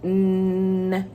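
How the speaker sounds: N as a long level "nnnn" hum; isolated letter sound. Shown as a demonstration. Teacher showing first sound of necklace, N hummed long. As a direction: hold N on one note, not the letter name. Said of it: "first sound is".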